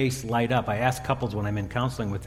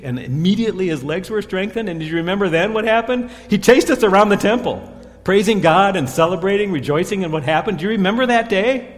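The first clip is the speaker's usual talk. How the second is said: much higher and louder, bursting with joy; excited retelling; 7 semitones higher, 7 dB louder than usual. Acted. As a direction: relive the miracle like you were there, thrilled. Disciple relives the healing day.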